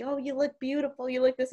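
soft, high mock cooing; imitated doting grandmother. Grandmother gushing over the family.

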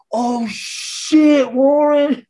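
high teasing mock surprise; a hint of stunned teasing; mock shocked. Teammates reacting to Warren's outburst, high mock shock.

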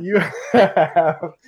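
slow words broken by laughter; can't get the words out for laughing. Real, not acted. Host laughing at his guest's accent.

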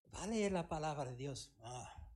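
tired, deflated answer; quiet resigned pastor; pastor's flat reply. Retold or imitated. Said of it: "E ele habla assim".

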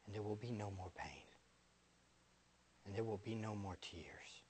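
soft, low whispered reverence, mostly unvoiced; hushed devotional. Preacher whispering to the congregation about eternity. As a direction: breathe it out like a quiet prayer. Hushed closing of a prayer-like passage.

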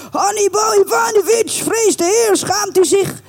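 high, sing-out admonishing call; exhorting appeal. Acted. Landlord pleading with drunken lodger's conscience, high admonishing call. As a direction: call him to shame, pitched right up high. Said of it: "op zijn gemoed ga werken".

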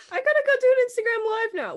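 high, loud, fast mock-cheerful excuse; overly chipper escape line; fake excuse. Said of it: "I was like".

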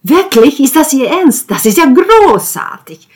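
high and loud, bursting with joy; overjoyed at the news; delighted. A narrator's reading. Delighted father offered a place. (begeistert, hoch und laut)

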